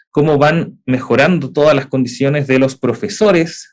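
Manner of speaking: normal talking voice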